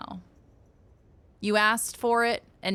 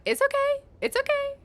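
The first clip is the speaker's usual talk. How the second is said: much higher comforting imitation of a partner; soothing partner voice. Laws consoling the streamer over spilled paella, higher soothing imitation. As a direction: imitate a partner calming you down. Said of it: "So Laws was like".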